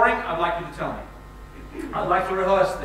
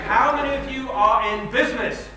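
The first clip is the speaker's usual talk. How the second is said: ordinary talk, nothing marked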